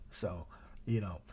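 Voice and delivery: breathy unvoiced aside; whisper. Real, not acted. Preacher aside to the congregation, whispering.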